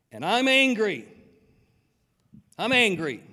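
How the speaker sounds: admitting anger. Shown as a demonstration. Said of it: "Do you have a right? I do".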